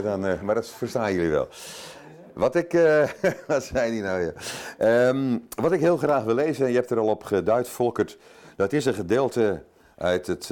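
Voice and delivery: ordinary talk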